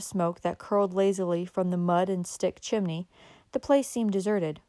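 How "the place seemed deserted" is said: plain reading voice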